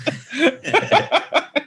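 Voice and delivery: high burst of real laughter; high-pitched real laugh. Real, not acted. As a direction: crack up laughing. Laughing at the story.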